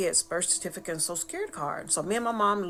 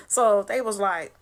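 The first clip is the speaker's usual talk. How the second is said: ordinary talk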